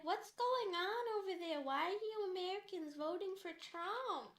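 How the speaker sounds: high, slow, exaggerated Canadian drawl; mock Canadian, slow and singsong; heavy Canadian accent. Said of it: "she has this really heavy Canadian accent".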